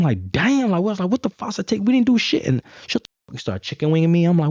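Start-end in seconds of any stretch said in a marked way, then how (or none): 0.3-2.4 high, incredulous protest; indignant disbelief. Retold or imitated. Nineteen-year-old protesting to cops, high indignant disbelief. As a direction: squeak out the outrage way up high.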